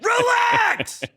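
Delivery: very high, loud screamed commands; imitated sideline scream. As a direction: scream it like a frantic youth-soccer coach on the sideline. Youth soccer coach screaming from sideline.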